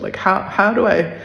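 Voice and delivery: ordinary delivery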